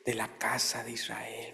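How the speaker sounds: hushed whispered quote. Acted. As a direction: whisper the words of Jesus. (susurro suave)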